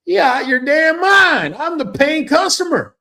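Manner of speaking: indignant retort. Retold or imitated. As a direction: fire it back like the offended paying customer. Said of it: "I'm like".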